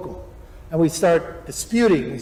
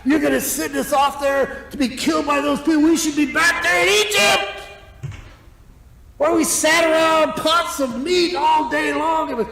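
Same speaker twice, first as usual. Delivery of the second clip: higher, mocking grumbling crowd; hammed-up moaning about Egypt; mock whiny complaint, rising to a shout; whining Israelites; 7 semitones higher than usual. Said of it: "they're grumbling".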